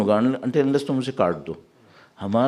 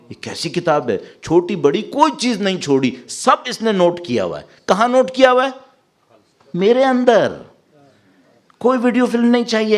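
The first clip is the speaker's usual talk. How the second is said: raised, high-pitched outcry, building in force; retold crying out; 9 semitones higher, 4 dB louder than usual. People shown their book of deeds, imitated loud cry. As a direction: act out an astonished cry, climbing to a loud peak. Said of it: "وہ چلا کے کہیں گے".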